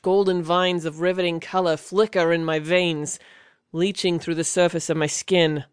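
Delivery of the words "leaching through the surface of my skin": ordinary delivery, nothing marked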